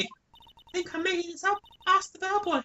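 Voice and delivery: high put-on girly witness voice; shrill April May voice. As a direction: snap at everyone like a spoiled starlet. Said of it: "doing this voice".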